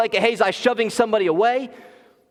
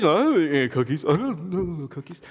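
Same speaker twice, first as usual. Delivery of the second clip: lightly voiced caught toddler; 2 semitones lower than usual. Toddler caught in cookie jar.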